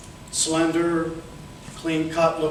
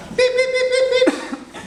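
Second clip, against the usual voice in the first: high, loud imitated alert beeps; radio beeps; 3 dB louder than usual. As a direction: beep like an emergency alert.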